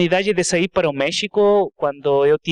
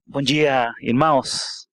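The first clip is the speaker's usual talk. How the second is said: Portuguese with a Spanish accent; non-native Portuguese, Spanish accent; 3 semitones lower than usual. Real, not acted. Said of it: "então desculpe meu português. Eu falo mel-, melhor inglês e espanhol".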